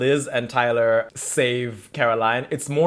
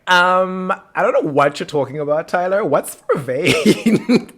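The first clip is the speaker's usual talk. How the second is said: high, hammed-up innocent act; feigned innocence; 6 semitones higher, 5 dB louder than usual. Mother denying the vervain to her son, feigned innocence. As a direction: play dumb, pitched up, stretching the last word.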